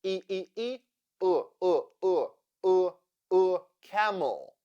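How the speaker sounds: E repeated, then UH repeated, into camel; schwa drill. Shown as a demonstration. Teacher drilling the unstressed second syllable of camel, schwa drill. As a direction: drill E turning into a neutral UH before camel. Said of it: "E sounds like uh".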